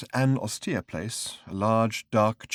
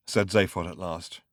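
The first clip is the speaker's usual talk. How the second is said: ordinary delivery, nothing marked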